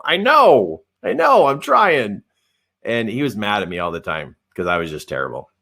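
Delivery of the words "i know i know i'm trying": high, pleading "I know"; pleading self. Retold or imitated. Answering the yelling boss, high and pleading. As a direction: plead helplessly, slower. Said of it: "And I go".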